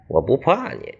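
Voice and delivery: plain reading voice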